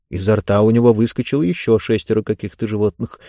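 ordinary delivery

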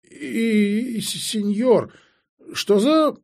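high, faltering voice; startled, squeaky stutter; flustered stammer. Acted. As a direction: stammer it weakly, pitched right up. Fainted girl stammers in shock.

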